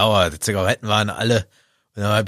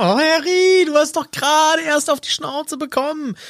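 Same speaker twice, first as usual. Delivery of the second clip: mock pleading to Harry. Podcaster imitating a fan thinking at Harry, high exasperated plea. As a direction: whine it out in a high pitch, half-despairing. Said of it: "da hab ich gedacht".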